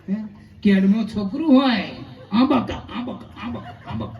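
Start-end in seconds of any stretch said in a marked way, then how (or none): 2.3-3.2 mock baby babble. Imitating baby-carrying mother. 3.4-4.1 "આંબક, આંબક" dropped much lower and much softer; a muttered "આંબક" repeated low and soft; low mumbled babble. Retold or imitated. Imitating baby-carrying mother.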